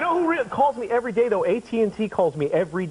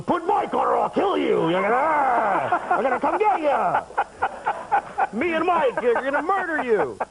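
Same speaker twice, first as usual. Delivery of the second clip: mock threatening; 8 semitones higher than usual. Caller redialing the old lady all day, hammed-up high threats. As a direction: menace her like a crazed prank caller.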